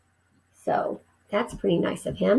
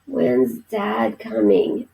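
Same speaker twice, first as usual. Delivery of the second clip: put-on sick child; 9 semitones lower, much slower than usual. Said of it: "asked Keith".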